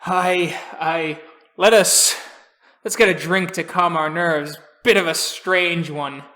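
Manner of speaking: loud, hearty seadog voice; old crusty sailor. Acted. Old sailor suggesting a drink to calm nerves, hearty and crusty. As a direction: boom it like an old salt. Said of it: "old, crusty sailor going".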